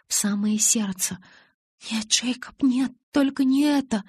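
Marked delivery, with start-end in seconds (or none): 1.9-4.0 gasped in horror. Acted. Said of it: "В ужасе выдохнула я".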